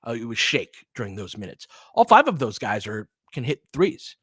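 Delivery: ordinary delivery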